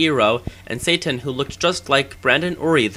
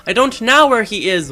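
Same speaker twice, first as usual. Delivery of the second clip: pleading denial; 8 semitones higher, 5 dB louder than usual. Acted. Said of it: "said Lupin".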